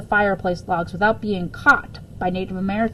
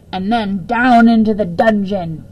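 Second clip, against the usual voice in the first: louder mock threat, playing it up; hammed-up dungeon warning; mock doom; 6 dB louder than usual. A narrator's reading. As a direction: boom out the dungeon like a spooky threat. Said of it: "Missus Piggle Wiggle said".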